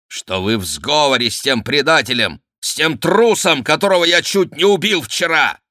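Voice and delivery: building to high, loud fury; accuse, heating up to the end; angry accusation. A narrator's reading. Count's angry accusation in a quarrel. (гневное обвинение, с нарастанием)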